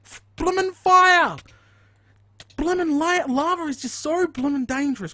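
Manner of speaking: high, loud panicked shrieks while burning; panicked yelling. Real, not acted. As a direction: yelp in real pain and panic. Burning in lava on stream.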